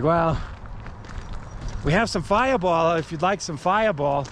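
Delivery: a lightly put-on Massachusetts accent, mock cheerful. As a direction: imitate a friendly Massachusetts local offering Fireball. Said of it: "Let me try and do my best Massachusetts accent".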